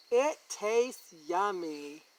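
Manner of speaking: plain reading voice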